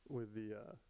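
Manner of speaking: ordinary talk, nothing marked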